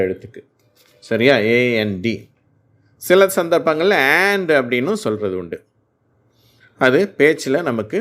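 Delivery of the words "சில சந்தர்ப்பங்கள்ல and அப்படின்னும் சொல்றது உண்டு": ordinary talk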